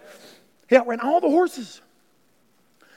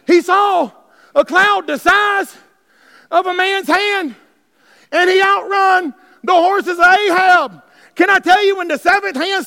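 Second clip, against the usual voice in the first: loud, high, slow emphatic preaching; impassioned slow preacher's shout; full-force preaching; 5 semitones higher, 6 dB louder than usual. Acted. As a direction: cry it out to the crowd.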